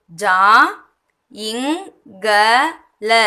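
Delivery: "ஜா, இங், க, ல" each said alone; word broken into isolated pieces; sound by sound. Shown as a demonstration. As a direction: pause after every sound, as for learners. Teacher splits word into sounds.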